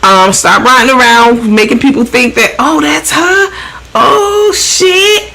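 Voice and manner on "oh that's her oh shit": high-pitched mimicked onlooker; excited onlooker. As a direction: draw it out high, as if spotting someone. Mimics onlookers spotting the truck.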